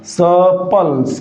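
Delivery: सपल्स very slow, no r sound; correct pronunciation. Shown as a demonstration. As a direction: draw out सपल्स slowly without the r. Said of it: "इसीलिए इसका उच्चारण क्या होगा?". (सपल्स, बहुत धीरे, बिना आर के)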